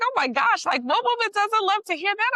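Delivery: high, gushing excited reaction; retold flattered delight. Gushing over a compliment on looks.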